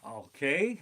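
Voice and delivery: ordinary talk, nothing marked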